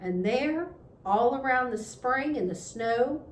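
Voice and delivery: ordinary delivery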